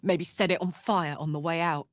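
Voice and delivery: ordinary talk, nothing marked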